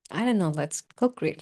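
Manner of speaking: ordinary delivery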